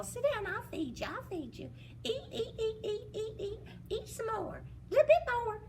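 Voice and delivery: put-on high coaxing servant girl voice. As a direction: urge the giant to eat. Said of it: "said the servant girl".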